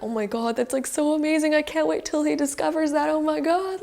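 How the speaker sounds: high, thrilled gushing; excited viewer. Retold or imitated. Viewer thrilled by the hero's future, high gush. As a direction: gush with eager anticipation. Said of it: "and we're like".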